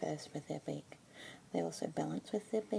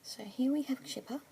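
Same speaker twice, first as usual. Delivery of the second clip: asmr whisper. Acted. Keeper introducing a budgie to a listener, whispered. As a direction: whisper gently, close to the mic.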